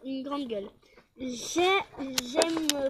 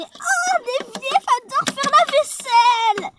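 put-on high voice; 10 semitones higher, 4 dB louder than usual. Voices a prissy housemate.

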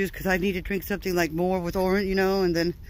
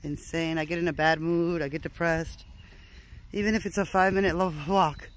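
ordinary talk

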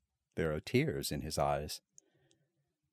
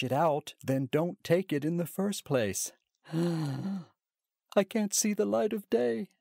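high tearful mockery; high whining tearful scorn; tearful mocking; 10 semitones higher than usual. A narrator's reading. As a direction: complain through tears, mocking him. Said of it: "in a tearful but at the same time mocking voice".

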